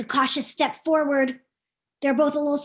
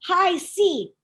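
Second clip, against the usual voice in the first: ordinary delivery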